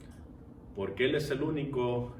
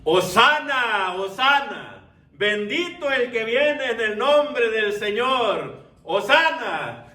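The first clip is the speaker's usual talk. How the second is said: loud, high, drawn-out acclamation; crowd shouting hosanna; 7 semitones higher, 6 dB louder, much slower than usual. Retold or imitated. Preacher voices the crowd at the triumphal entry, shouting. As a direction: shout it slowly like a jubilant multitude. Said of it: "gritando y diciendo".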